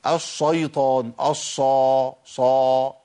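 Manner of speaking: الشيطان with a thick, dark sho; heavy shin. Retold or imitated. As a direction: imitate people who darken ش in الشيطان. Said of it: "يا عم مش الصا".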